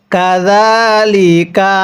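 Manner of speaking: "কাযালিকা" very slow, za held one alif, ka short; stretched za. Shown as a demonstration. Teacher shows the stretch on za, not on ka. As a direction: hold the middle vowel of "কাযালিকা". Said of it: "জালের মধ্যে এক আলিফ টান দিব".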